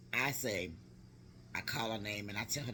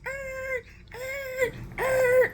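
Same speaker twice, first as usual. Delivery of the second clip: high screeches growing louder; imitated screech; 10 dB louder, much slower than usual. Noise heard as niece runs outside, imitated screeching. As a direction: mimic repeated screeches, building to very loud.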